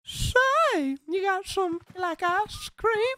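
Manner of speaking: put-on a creepy old man calling out, slow and high; Herbert the Pervert. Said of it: "I'd be able to do the voice".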